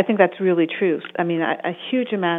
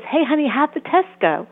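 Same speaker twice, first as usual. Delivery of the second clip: higher, sweet supportive mom voice; loving mommy tone; 7 semitones higher than usual. Retold or imitated. Mother texting her teen about a test, loving and higher. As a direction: ask it like a caring mom. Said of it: "your loving, supportive mommy tone of voice".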